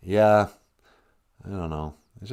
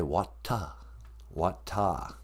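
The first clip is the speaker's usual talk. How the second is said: put-on English accent; 5 semitones lower, 5 dB quieter, much slower than usual. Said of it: "[name] has an English accent".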